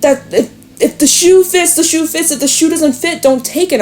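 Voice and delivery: high, pointed retort; sassy comeback. Retold or imitated. Reader answering an offended commenter, sassy and high. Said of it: "I'm like".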